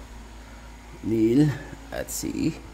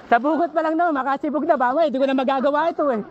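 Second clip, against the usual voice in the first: excited high voice; 11 semitones higher, faster than usual. Real, not acted.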